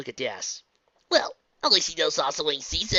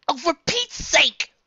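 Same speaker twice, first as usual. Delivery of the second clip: exasperated; 8 dB louder, much slower than usual. Acted. Dash exasperated.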